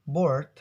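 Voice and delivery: "board" punched out with the R sounded; American R pronounced. Shown as a demonstration. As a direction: hit "board" with an audible American r. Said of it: "So instead of saying".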